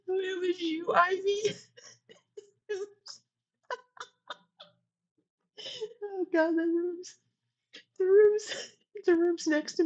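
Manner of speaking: high, breaking laugh through the words; laughing so hard it nearly turns to tears; helpless laughter. Real, not acted. Laughing on a call at her friend Ivy's story, real helpless laughter.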